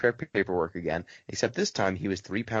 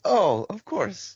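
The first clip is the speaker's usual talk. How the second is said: much higher, slow, cheerful foreign voice; upbeat old man; 12 semitones higher, 4 dB louder, slower than usual. Retold or imitated. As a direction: beam it out like a happy old man. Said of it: "he's so upbeat".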